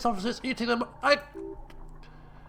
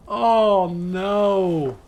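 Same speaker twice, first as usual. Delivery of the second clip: normal talking voice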